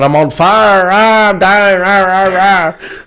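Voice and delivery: high, drawn-out cries of dying; mock yelling. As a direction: yell as if burning, playing it up. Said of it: "they all scream and I'm yelling".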